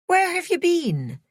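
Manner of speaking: put-on pleading Irish boyfriend voice, high and worried; high, reedy Irish accent, upset. A narrator's reading. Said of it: "He had an Irish accent".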